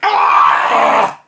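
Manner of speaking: loud hammed-up pain shout; mock pain shout. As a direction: yell in mock agony.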